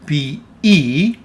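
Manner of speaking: "P E" said carefully as two syllables, pee-EE; pronunciation demo. Shown as a demonstration. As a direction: sound out "P E" for a learner, ending on a long ee. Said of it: "pay attention to the pronunciation here".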